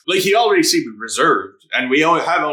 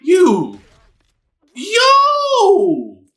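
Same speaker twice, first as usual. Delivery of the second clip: real shout; 5 dB louder than usual. Real, not acted. Yelling at the screen.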